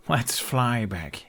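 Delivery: slow, a hint of boyish fondness; drawn out, fond teasing; lightly voiced Hiccup. A narrator's reading. Hiccup fondly invites his dragon to fly back, lightly voiced.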